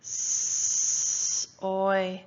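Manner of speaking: long S, then a separate OI; a held sss, a pause into oi; sounding out. Shown as a demonstration. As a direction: sound out S- and oi as two slow pieces. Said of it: "Yes, the letter S".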